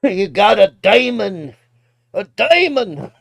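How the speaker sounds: loud, high hammed-up preacher cry; mock Texas preacher. Streamer mocking a Texas preacher video, loud hammed-up preacher shout. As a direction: proclaim it like a fired-up preacher. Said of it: "every preacher in Texas".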